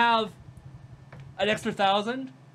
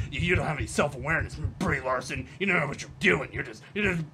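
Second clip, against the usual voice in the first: lightly voiced fast mock of him; mocking Jeremy; 6 semitones lower, faster than usual. She parodies Jeremy scolding Brie Larson, a light mock voice. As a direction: rattle off his scolding, slightly lower. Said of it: "coming from this guy".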